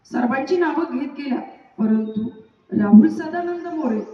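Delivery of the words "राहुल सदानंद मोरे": loud roll call; teacher calling the roll loudly; calling name out. Acted. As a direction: call the name across the class.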